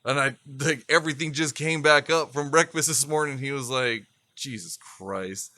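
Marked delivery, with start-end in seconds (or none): 4.3-5.5 low, soft, slow muttered oath; dad's weary disgust. Retold or imitated. Dad hearing why his son puked, weary mutter. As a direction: imitate a father sighing out his disbelief.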